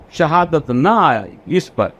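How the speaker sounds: usual speaking voice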